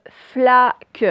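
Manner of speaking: "flaque" loud, split slowly into "fla" and "que"; slow dictation word. Shown as a demonstration. Teacher giving pupils a word to analyse, slow syllabled dictation. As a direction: break "flaque" into two drawn-out syllables. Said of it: "première syllabe".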